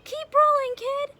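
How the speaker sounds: loud, high shout; yelling. Acted. Marvin pushing Ricky down the hill, loud yell. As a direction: holler it as a rousing push.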